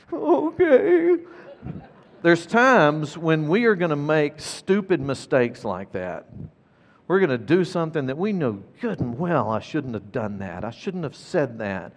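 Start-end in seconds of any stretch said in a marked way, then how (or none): 0.1-1.2 put-on tiny, very high child voice; high imitated kid's reply; little child accepting. Imitates tearful son accepting apology. 9.5-11.9 low, soft admission to oneself; hushed, lowered self-reproach; quiet regret. Acted. Voices a parent's private regret.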